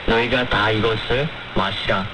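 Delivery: ordinary talk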